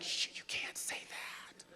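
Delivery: mock whisper, slow and hushed; hushed warning. Comic mocking people who hush every joke, whispering. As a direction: shush the room like it's forbidden. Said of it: "it's like".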